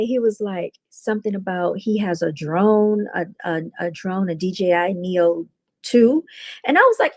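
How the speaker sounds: ordinary talk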